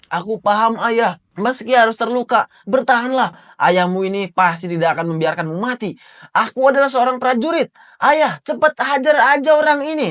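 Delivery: put-on a boy trying to sound like a soldier; brave son. Son telling his father to fight, high and earnest. Recapper voices son Kolon.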